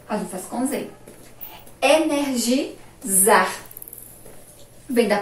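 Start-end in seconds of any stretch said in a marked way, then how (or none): 1.8-3.5 "Energizar" split before its last syllable; slow dictation. Shown as a demonstration. Teacher dictating to the class, slow broken syllables. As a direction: sound it out slowly for spelling.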